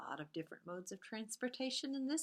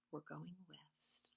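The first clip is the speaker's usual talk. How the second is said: whispered; 4 semitones lower, 10 dB quieter than usual. A narrator's reading. Whispered line ending.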